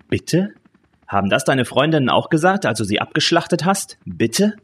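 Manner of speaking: high, mocking, accusing questions; angry snapping. A narrator's reading. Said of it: "fuhr der Mann sie an". (höhnisch und hoch anfahren)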